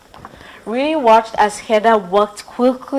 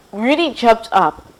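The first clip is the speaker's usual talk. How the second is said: plain reading voice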